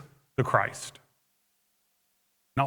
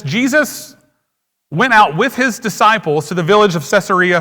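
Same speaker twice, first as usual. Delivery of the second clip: loud, ringing scripture read from the pulpit; 8 dB louder than usual. Acted. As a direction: proclaim the text to the whole church. Reads the scripture aloud.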